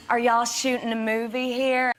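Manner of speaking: imitated Southern twang; Southern accent. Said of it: "a little bit Southerny".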